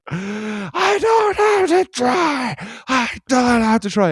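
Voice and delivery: imitated high, drawn-out tantrum shriek; loud, slow, high-pitched mock wail; screaming child. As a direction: scream it like a kid mid-meltdown. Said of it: "The kid just fucking screams".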